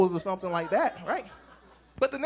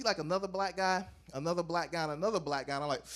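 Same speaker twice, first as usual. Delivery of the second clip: normal talking voice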